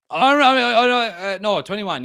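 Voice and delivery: high, loud fluster, easing off; panicked backtracking. Acted. United scrambling to save a transfer deal, flustered. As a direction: stammer loudly as if the deal is slipping.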